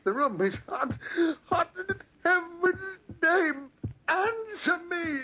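put-on an old man begging into the dark; terrified old man. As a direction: plead in rising panic. Old man panicking, near crying.

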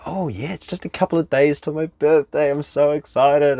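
high-pitched, put-on excitement; excited hinting. Partner reminding him days before the birthday, hammed-up excitement. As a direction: gush it high, as a cheery reminder.

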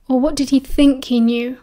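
usual speaking voice

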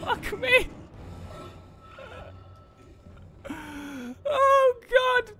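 high gasping outburst; frightened cry. Real, not acted. Crying out after escaping the monster. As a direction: cry out in shock.

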